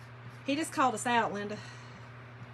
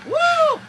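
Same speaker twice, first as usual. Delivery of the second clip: high, loud "woo"; whoop; 10 dB louder than usual. Real, not acted. Vlogger capping her funny story, high whoop.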